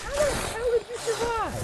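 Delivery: real exasperated outburst; disbelieving yell. Real, not acted. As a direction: yell at the enemy that survived. Yelling at game enemy.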